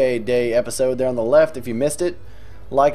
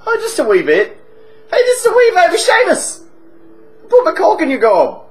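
boozy Irish pub regular, chatty and cheeky; hammed-up Irish drunk telling a tale; stage Irish accent; 6 dB louder than usual. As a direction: lay on a thick comic Irish brogue. Held Irish voice.

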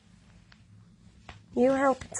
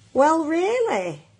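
high-pitched huffy exclamation; indignant; 8 semitones higher than usual. A narrator's reading. Mog the cat resents kitten at her dish, indignant. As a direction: swoop up high in affronted disbelief. Offended cat's thought, storybook.

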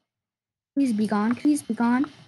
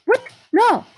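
scared scream; 10 semitones higher, 5 dB louder than usual. Real, not acted. Jump scare in horror game.

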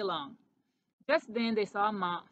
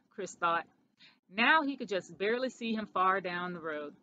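usual speaking voice

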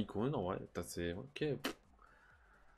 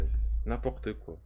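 ordinary talk, nothing marked

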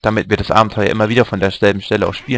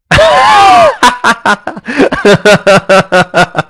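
loud high laugh; 12 semitones higher, 5 dB louder than usual. Real, not acted. Laughing at the secret wish in the diary, loud shrieking laugh.

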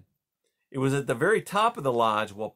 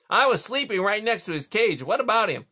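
higher, louder gruff beaver voice; a sleepy beaver calling across the pond; 9 semitones higher, 4 dB louder than usual. Acted. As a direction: beaver asking who's there, pitched up. Beaver dialogue.

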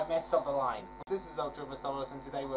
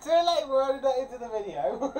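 laughing; 9 semitones higher, 9 dB louder than usual. Real, not acted. Teasing while laughing.

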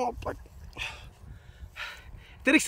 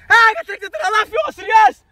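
verontwaardigd uitroepen; 9 semitones higher, 5 dB louder than usual. Real, not acted. Klaagt terwijl strip eraf gaat.

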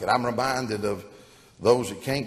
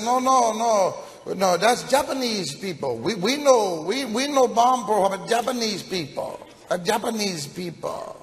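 high and louder, quick no-no-no protests; protesting Chinese man voice; 11 semitones higher, 3 dB louder than usual. Acted. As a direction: correct him urgently in a high voice. Co-pilot protests, correcting the pilot.

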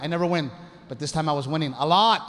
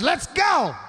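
sharp command; 11 semitones higher, 3 dB louder than usual. Retold or imitated. Wife commands husband.

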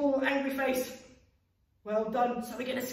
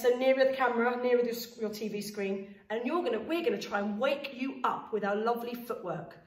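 ordinary delivery

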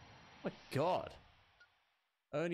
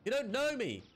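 higher, loud defiant yell; defiant shout; 8 semitones higher, 3 dB louder than usual. Real, not acted. Gamer yelling at Fox in defiance. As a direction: hurl it at him.

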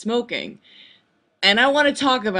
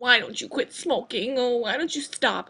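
nagging mom voice; 5 semitones higher, 6 dB quieter than usual. Retold or imitated.

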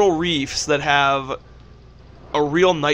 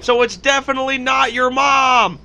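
louder, higher shouted taunt; mock taunt; 9 semitones higher, 4 dB louder than usual. Roasting a friend by name, shouted mock taunt. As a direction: yell a roast at your friend.

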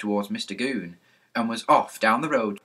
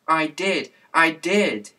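put-on higher and louder, a child's mild wailing plea; 6 semitones higher, 5 dB louder, slower than usual. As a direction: whimper it like a disappointed little girl. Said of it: "she wailed".

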